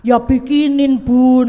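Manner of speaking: put-on a touch of a pushy kid calling out; child whining. Mother voicing her child demanding food, mildly loud. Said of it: "Dia ke kamar saya".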